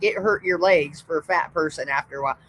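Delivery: usual speaking voice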